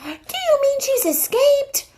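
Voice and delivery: very high, startled voice; shocked question. Acted. Rescuer learns the witch escaped, high and shocked. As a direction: gasp it out in disbelief.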